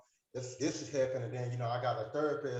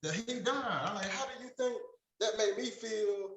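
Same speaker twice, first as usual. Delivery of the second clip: incredulous protest; 9 semitones higher than usual. Retold or imitated. Retelling shock at doctor's request.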